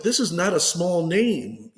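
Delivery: high, slow, loud emphasis tipping into laughter; amused amazement. Retold or imitated. Athlete learns who may play him, amazed and amused. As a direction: marvel at it, laugh rising underneath. Amazed by famous casting, retold.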